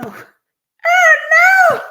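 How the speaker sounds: squealed oh no, high and loud; a shrill cry of mock alarm; high dismayed cry. Real, not acted. Dismay at phone mistake.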